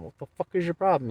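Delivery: quick, high retold confrontation; indignant challenge. Driver jumps out to confront the man, indignant. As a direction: fire it off fast and high. Retells jumping out to confront him.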